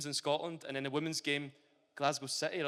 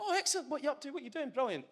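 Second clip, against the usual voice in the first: much higher, gushing mock reply from a teammate; eager teammate reply; 12 semitones higher, 4 dB louder, faster than usual. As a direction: chirp it up high like a delighted teammate. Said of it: "they could go".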